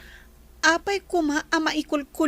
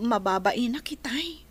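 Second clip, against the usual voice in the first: ordinary talk, nothing marked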